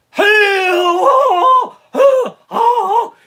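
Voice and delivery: very high, loud wailing hammed-up cries; theatrical umpire shout. Umpire calling strike three, loud theatrical high wailing. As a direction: ham it up like an umpire's drama scene. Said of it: "He's like".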